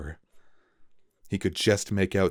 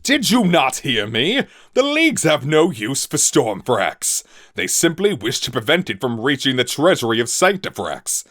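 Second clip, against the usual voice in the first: high, loud commanding captain; furious sky captain; 6 semitones higher, 7 dB louder than usual. A narrator's reading. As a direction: berate the traitor from the helm.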